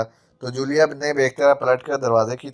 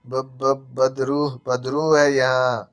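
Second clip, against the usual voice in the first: usual speaking voice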